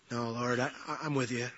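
low, very soft, halting; meek reply. Acted. Peter reassuring Jesus of his loyalty, meekly. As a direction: answer quietly, stumbling a little.

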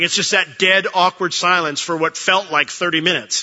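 ordinary talk

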